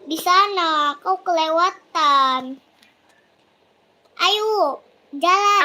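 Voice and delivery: loud, impatient call to go; impatient shout. Real, not acted. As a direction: shout at them to hurry. Urging friend to move.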